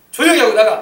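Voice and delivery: loud, forceful order to a demon; commanding rebuke. Shown as a demonstration. Preacher demonstrating a command to the evil spirit, loud rebuke. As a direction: shout it down with full authority. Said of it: "명령하시길 바랍니다".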